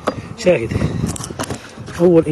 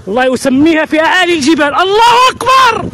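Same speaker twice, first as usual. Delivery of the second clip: raised pitch soaring into a high cry; building exultation; 5 semitones higher than usual. Real, not acted. Beekeeper praising his mountain honey, exultant.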